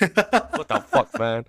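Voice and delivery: drawn-out, high incredulous; slow stunned what the fuck; slow disbelief. Real, not acted. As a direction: stretch it in disbelief.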